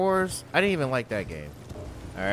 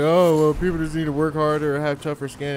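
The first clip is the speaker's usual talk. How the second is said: mocking know-it-all; 3 semitones higher, 4 dB louder than usual. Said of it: "people are like".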